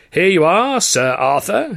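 put-on high, loud and hammy old trouper; hearty theatrical gent. A narrator's reading. George gushing to Arthur about the contest, hearty theatrical. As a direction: play the grand showman gushing.

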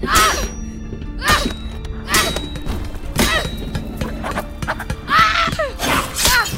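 short angry cries of frustration; frustrated yell. Acted. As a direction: let out sharp frustrated shouts.

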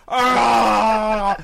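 loud, high mock roar; straining snake cry. Storyteller voices the snake trying so hard to bite, loud mock cry. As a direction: sustain a straining yell as if biting with all your might. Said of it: "the snake's like".

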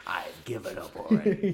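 loud, slowed-down mock quote; mock giving up. Teasing co-host over the whiskey episode, loud mocking imitation. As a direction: throw it back at your co-host, loud and drawn out. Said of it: "now you're just like".

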